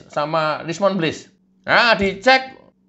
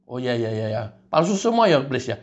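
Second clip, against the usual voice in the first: meek low agreement; 7 semitones lower than usual. Acted. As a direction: drop low and give in. Rismond meekly agreeing again.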